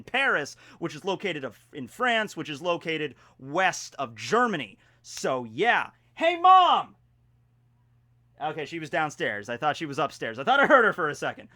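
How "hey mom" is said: shouted high call. Real, not acted. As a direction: call out to someone downstairs. Calling out to mom downstairs.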